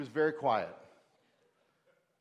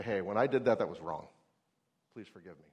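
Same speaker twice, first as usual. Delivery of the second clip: gentle apology; 6 semitones lower than usual. Retold or imitated. Apologizing to child retold.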